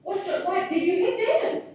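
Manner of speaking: sing-song mommy asking why; mom questioning child. Retold or imitated. Mother questioning her child, retold.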